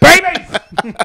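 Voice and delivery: very high, loud yell; screaming at players. Retold or imitated. Fan screaming at players for no reason, frustrated shout. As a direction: finish the heckle at full shout. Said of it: "That's me just screaming at him".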